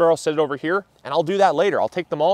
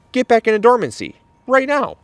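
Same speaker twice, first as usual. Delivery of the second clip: higher, louder mock order to the seedlings; mock stern command; 8 semitones higher, 3 dB louder than usual. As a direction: boss the plants back into the ground, half joking. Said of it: "I tried talking them out of growing".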